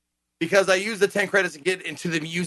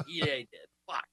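normal talking voice

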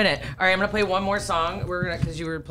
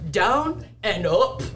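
put-on Arnold accent; much slower than usual. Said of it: "he goes".

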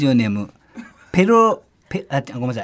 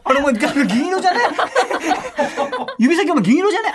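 surprised; 6 semitones higher than usual. Acted. Acted scene, friend noticing.

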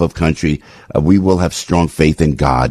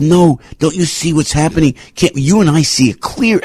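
mock yell; 11 semitones higher than usual. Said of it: "to yell people".

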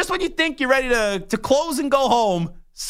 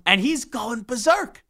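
ordinary talk, nothing marked

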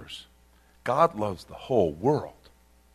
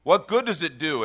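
usual speaking voice

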